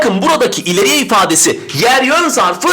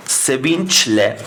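slow demo; 7 semitones lower, 3 dB quieter, much slower than usual. Shown as a demonstration. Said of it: "Mesela desem ki".

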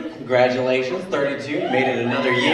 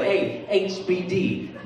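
slow, softer mock greeting; imitated minimal effort; 3 semitones higher, 6 dB quieter, much slower than usual. Lazy Facebook friend typing a birthday wish, slow and offhand. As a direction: drag out a token birthday abbreviation. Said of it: "those people who are like".

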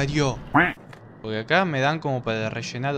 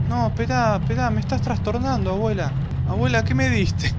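panicking gamer; 9 semitones higher than usual. Real, not acted. Pleading with creepy grandma ghost.

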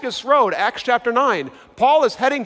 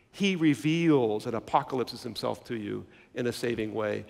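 low, soft and measured; solemn hushed preaching; 8 semitones lower, 7 dB quieter than usual. Acted. Preacher giving Jesus' blessing to the congregation, hushed and solemn. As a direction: bring the sermon down to a reverent hush.

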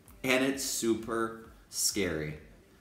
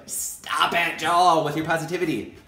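laughing colleague; 5 semitones higher, 7 dB louder than usual. Retold or imitated. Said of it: "they start laughing".

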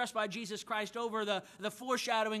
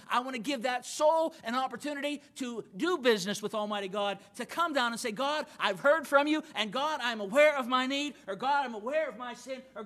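raised, driving preacher's voice, pushing every phrase; preaching; 2 semitones higher, 4 dB louder than usual. Acted. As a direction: preach it hard to the congregation, full conviction. Pastor preaching to his congregation.

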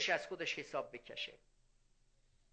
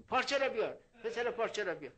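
mock demand; 5 semitones higher, 4 dB louder, faster than usual. Preacher demands the cloth back.

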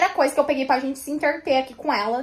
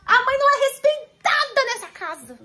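much higher, exasperated comic rant; mock outrage; 9 semitones higher than usual. Mother ranting to viewers that dad gave the child the phone, much higher. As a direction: play the indignant mom for the camera.

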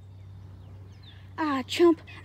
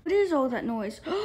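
ordinary delivery

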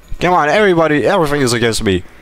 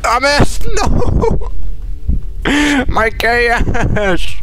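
high, loud laughing wail over the loss; laughing despair; 10 semitones higher, 3 dB louder, much slower than usual. Real, not acted. Player losing his gun, wailing and laughing. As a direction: laugh and cry out in dismay. Loses weapon in fight, dismay.

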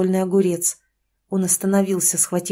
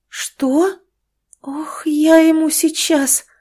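shocked outburst; 8 semitones higher, 4 dB louder, much slower than usual. Acted. Mother learns son drank.

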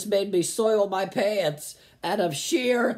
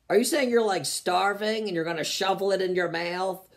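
ordinary talk, nothing marked

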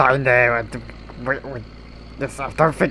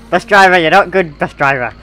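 loud, higher telling-off; mock scolding; 5 semitones higher, 9 dB louder than usual. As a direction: yell at the bad driver. Scolding the driver.

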